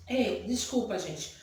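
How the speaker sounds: normal talking voice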